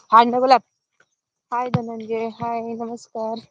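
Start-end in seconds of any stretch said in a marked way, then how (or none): 0.1-0.6 sudden loud yell; short, forceful real shout; herding shout. Real, not acted. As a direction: holler at the straying animal. Breaks off greeting to shout at animal.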